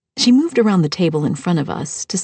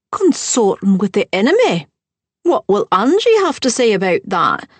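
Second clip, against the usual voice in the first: higher, louder sneering stepmother voice; snide stepmother; 4 semitones higher, 5 dB louder than usual. Acted. Stepmother mocking the narrator for sitting with the enemy, higher and louder. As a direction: lift it up and sneer at the guests. Stepmother taunting at wedding party.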